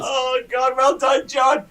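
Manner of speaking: high, loud mock congratulations; sarcastic shout. Real, not acted. Co-host to John who locked himself out, loud jeering shout. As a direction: call out "well done" over the laughter.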